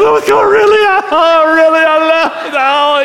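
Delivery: high, loud mock gush; excited gushing. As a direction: squeal it like a thrilled wife. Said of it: "she goes".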